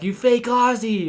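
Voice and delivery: high, loud, drawn-out jeer; mock accusation. Friends making fun of the fake Australian, mock outrage. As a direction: call him out loudly, stretching it out. Retold mocking comments on his post.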